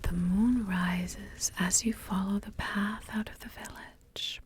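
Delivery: ordinary talk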